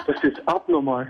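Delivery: high, slow words through laughter; laughing at the memory. Real, not acted. Caller recalling the swimming-pool date, laughing.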